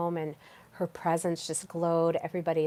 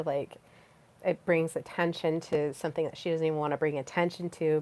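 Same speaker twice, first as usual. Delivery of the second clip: ordinary delivery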